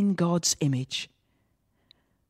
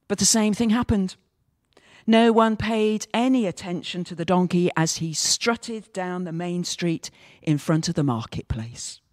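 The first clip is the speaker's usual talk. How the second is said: normal talking voice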